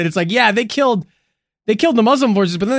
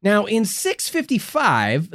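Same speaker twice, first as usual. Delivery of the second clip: ordinary talk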